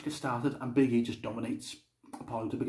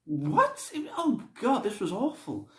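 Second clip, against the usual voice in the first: high-pitched, incredulous dismay; baffled outburst, voice jumping up; appalled disbelief; 12 semitones higher, slower than usual. Retold or imitated. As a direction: react aghast to a terrible ending. Reacting to bizarre match ending.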